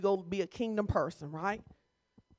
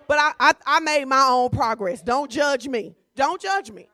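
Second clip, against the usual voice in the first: playful defiance; 8 dB louder than usual. Real, not acted. Playful defensive exclaim.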